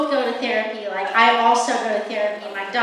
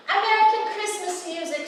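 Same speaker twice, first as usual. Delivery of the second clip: ordinary delivery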